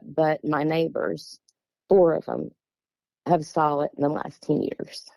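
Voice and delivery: ordinary talk, nothing marked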